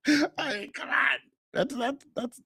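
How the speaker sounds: squeezed out while amused; cracking up. Real, not acted. Host reacting to an outrageous joke, high and cracked up.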